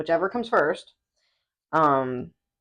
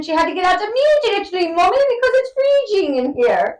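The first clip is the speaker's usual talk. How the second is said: high-pitched British whine to mum; imitated English child's accent; 11 semitones higher, 5 dB louder than usual. Retelling child in cold cruise pool, high British accent. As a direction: squeal it as an English kid. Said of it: "speaking in this goddamn fucking accent".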